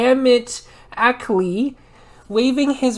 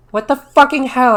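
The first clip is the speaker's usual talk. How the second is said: loud, higher, indignant demand; angry; 4 semitones higher, 7 dB louder than usual. A narrator's reading. Ebony demanding answers from Draco, angry and loud. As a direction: bite out the question angrily. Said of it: "I asked angrily".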